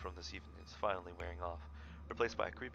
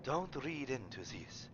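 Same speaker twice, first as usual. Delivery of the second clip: put-on light German accent; 6 dB louder, slower than usual. Streamer doing the German character, light German accent. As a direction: read the line in a German accent. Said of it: "gotta do a German accent".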